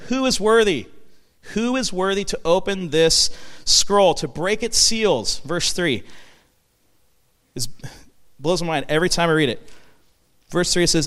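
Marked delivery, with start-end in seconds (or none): 0.1-5.4 angel's cry. Acted. Preacher acts out angel's cry.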